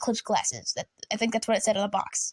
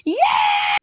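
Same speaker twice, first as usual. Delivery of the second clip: high, loud squeal of joy; thrilled yell; 6 dB louder than usual. Retold or imitated. Kid hears eclipse news, squeals with joy. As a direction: shriek it like the best news ever.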